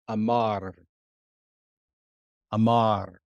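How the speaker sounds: "amar" said slowly and clearly, stress on the final "mar"; Hebrew word amar. Shown as a demonstration. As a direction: say "amar" as a careful teacher's model. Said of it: "the core root letter of this word".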